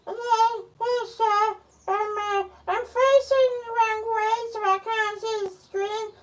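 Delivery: very high, squeaky little voice; high-pitched toy character. Acted. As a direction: pipe up in a tiny high voice.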